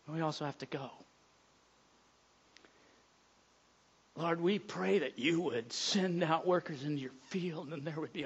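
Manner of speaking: soft, hushed pastoral prayer. Acted. Pastor praying over his church for workers, soft and hushed. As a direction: pray quietly over the congregation.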